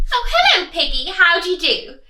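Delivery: high, loud piglet voice; eager piglet on phone; pig greeting. Acted. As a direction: greet her politely.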